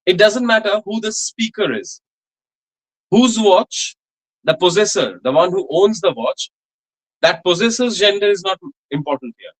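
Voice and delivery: ordinary talk, nothing marked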